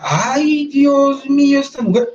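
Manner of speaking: high-pitched mock gasp of shock; ay Dios mío, pitched high in amazement; retold astonishment. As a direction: act out the stunned finders. Retold discovery of the belt.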